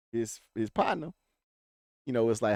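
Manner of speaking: usual speaking voice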